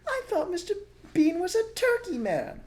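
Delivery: small fox cub voice. A narrator's reading. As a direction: squeak it like a tiny eager cub.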